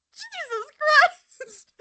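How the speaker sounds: very high, drawn-out squeal, imitating her; mock shocked exclamation. Host acts out her reaction to him, high mock-shocked squeal. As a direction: squeak it out slowly, hammed-up shock. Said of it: "she's like".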